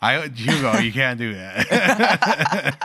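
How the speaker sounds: real laughing exasperation, drawn out; laughing scolding. Real, not acted. Laughing scolding after the NyQuil joke.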